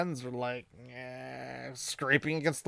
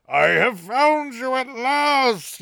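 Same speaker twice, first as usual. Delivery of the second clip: slow, high, louder ghostly call; creepy eerie voice; 9 semitones higher, 7 dB louder, much slower than usual. Retold or imitated. A mysterious voice calls out to Rand, slow and eerie. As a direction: drag it out like a haunting voice from nowhere. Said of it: "You know, creepy, eerie voice".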